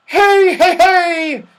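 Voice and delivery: very high, loud hailing yell; excited yell. Acted. Saucepan man greeting his friend from above, excited yell. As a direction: yell hey to get attention from afar. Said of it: "he yelled".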